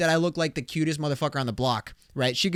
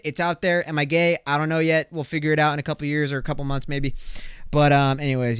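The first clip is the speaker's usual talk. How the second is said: usual speaking voice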